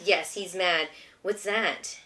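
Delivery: ordinary delivery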